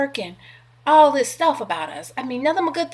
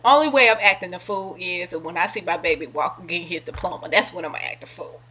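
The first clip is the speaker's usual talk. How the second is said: usual speaking voice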